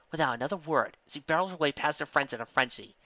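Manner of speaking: usual speaking voice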